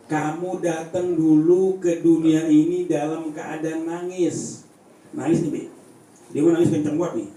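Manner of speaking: normal talking voice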